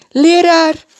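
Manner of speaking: high, loud cry of recognition. Acted. Maria recognizes Jesus and cries out joyfully. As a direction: burst out with joy. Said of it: "riep ze uit".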